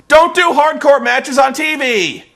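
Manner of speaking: loud and high, fed up with the bookers; exasperated shout. Real, not acted. Ranting at the bookers, a fed-up loud shout. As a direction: yell the rule at them. Ranting about booking choices.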